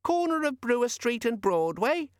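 very high, tinny little chirp; sing-song gadget voice. Acted. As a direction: chirp like a tiny imp in a box. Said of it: "said the little sing-song voice".

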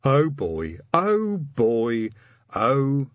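troll voice climbing, then breaking off; hesitating troll. A narrator's reading. Troll officer fumbles for the word, hesitating. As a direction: build up, then stall on the last word. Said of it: "Detritus hesitated".

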